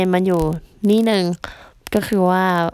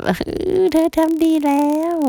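mock consoling; 9 semitones higher than usual. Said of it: "ทุกคนก็แบบ".

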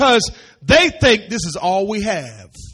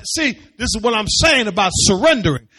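ordinary talk

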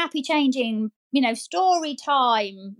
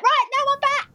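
high, eager and bouncy; rally the children for an adventure; energised return; 13 semitones higher than usual. Retold or imitated. Worker back next day rallying the room, high and eager.

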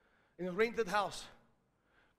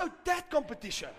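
higher, suddenly agreeable; sudden change of tune; 6 semitones higher than usual. Retold or imitated. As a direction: act as if it just came back to you. Quickly, how you can change.

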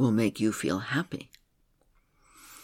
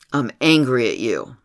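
angry; 7 dB louder than usual. Retold or imitated. Client admits anger to therapist.

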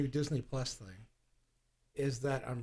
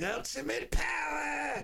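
slow, high, shouted cackling power; hammed-up evil Emperor gloating; Emperor impression; 13 semitones higher, slower than usual. Imitating the Emperor frying Luke, hammed-up shout. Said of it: "And he's like".

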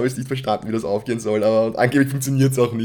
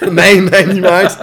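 lauter Protest; 9 semitones higher, 15 dB louder, slower than usual. Pretend. Lachender, gespielt empörter Widerspruch.

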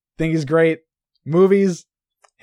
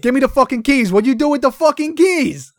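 higher, louder hammed-up Boston tough guy; mock Boston gangster; 6 semitones higher, 3 dB louder than usual. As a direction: yell it like a Goodfellas wise guy. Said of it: "you're doing Goodfellas right now".

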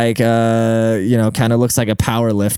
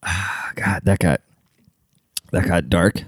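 ordinary delivery

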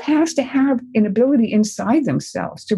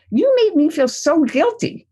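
higher, blaming parent's complaint; mild mock reproach from a parent; guilty accusation; 7 semitones higher than usual. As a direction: sound hurt and put upon. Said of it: "This is how parents often react".

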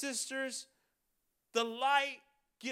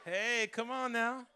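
ordinary talk, nothing marked

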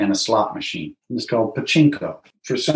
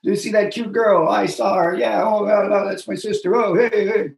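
high-pitched, rolling backstage chatter; bantering Japanese comedians; 8 semitones higher than usual. Retold or imitated. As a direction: toss out overlapping heys like guys bickering over cards. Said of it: "they're always talking".

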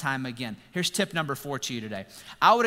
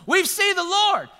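high, loud, slow burst of joy; excited announcement; 7 dB louder, slower than usual. Retold or imitated. Disciples tell Thomas, high and thrilled. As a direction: announce it like wonderful news.

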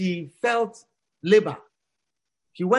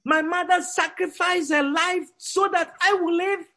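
tearful outburst; 8 semitones higher than usual. Acted. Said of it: "the young man broke down, crying".

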